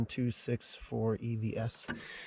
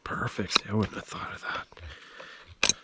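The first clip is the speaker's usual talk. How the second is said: ordinary talk, nothing marked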